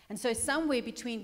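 ordinary talk, nothing marked

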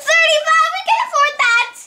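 high, loud, hurried outcry at a price; shocked exclamation. Pretend. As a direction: blurt it out high and fast.

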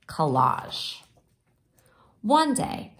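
ordinary delivery

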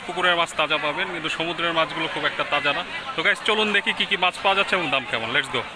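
ordinary delivery

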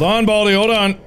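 high, urgent plea to wait; urgent stalling. Real, not acted. Player to approaching Baldi, urgently stalling.

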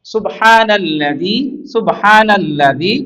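slow reading with held long vowels; stretched, even syllables, as in a reading drill; drawn-out recitation drill. Shown as a demonstration. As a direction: model সুবহানাল্লাযী slowly for learners. Teacher repeats the slow model reading.

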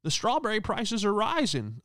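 high mock old lady voice. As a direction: gasp like an eighty-year-old at prices. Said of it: "It's like".

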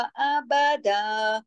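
slow अबदा, short a and ba, long daa; measured length. Shown as a demonstration. Teacher counts vowel lengths, अबदा with a stretched ending. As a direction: hold only the daa of अबदा. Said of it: "दा दो हरकत पढ़ा जाएगा".

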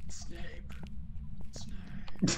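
whispered name. Acted. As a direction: breathe the name out.

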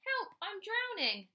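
high, alarmed call for rescue; cry for help. A narrator's reading. Rabbit drowning in the pond, crying for help. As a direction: call out as if going under.

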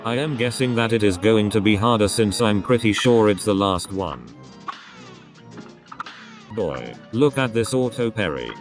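normal talking voice

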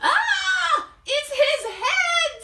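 terrified at the soup; horrified shriek. Acted. B B sees a head.

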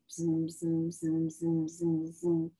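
low, steady pulsed "zzz"; buzzing sound effect. Acted. Storyteller voices the stains merging, rhythmic low buzzing. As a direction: buzz in even low pulses.

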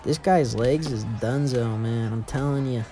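usual speaking voice

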